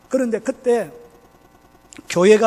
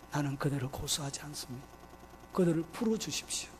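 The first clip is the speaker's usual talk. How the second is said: much softer, low voice of an injured man; pained, subdued plea; 8 semitones lower, 12 dB quieter than usual. Acted. Beaten pastor pardoning his attackers to police, pained quiet plea. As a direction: speak quietly through whole-body pain. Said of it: "온몸의 통증을 느끼면서 했던 이야기가".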